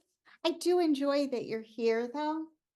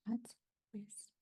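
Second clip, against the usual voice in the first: barely audible, much softer plea; hushed; 11 dB quieter than usual. Acted. Woman begging her chatty friend for quiet, hushed. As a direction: plead almost under your breath.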